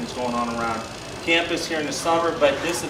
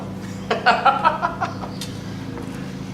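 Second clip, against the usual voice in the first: high breathy laugh. Real, not acted. Joking with the mascot.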